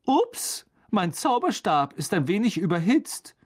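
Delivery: high 'oops', then lighter chatter; breezy excuse. A narrator's reading. Teacher excusing his dropped wand, high and breezy. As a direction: pipe up to cover a blunder.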